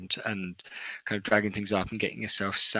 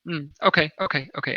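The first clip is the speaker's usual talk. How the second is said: put-on young woman; 6 semitones higher, 5 dB louder, faster than usual. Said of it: "a young woman".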